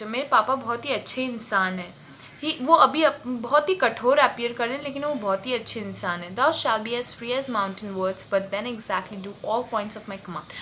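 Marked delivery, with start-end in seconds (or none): 6.4-10.5 slow, deliberate Shakespeare recital; teacher reading a play aloud to students; slow dramatic reading. Acted. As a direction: read the verse slowly, weighing each word. Reads Prospero's lines slowly.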